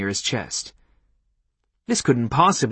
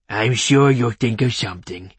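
cartoonish, trusting dog voice; talking dog; 4 dB louder than usual. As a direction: speak as a loyal dog egging on the owner. Dog answers his owner.

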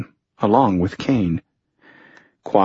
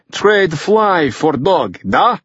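put-on high, slow Russian-accented haggling; drawn-out Russian accent, pitched up; broken English, Russian accent; 8 semitones higher, slower than usual. A narrator's reading. Fyodor bargains for the dog, in Russian-accented broken English. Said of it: "in badly broken English".

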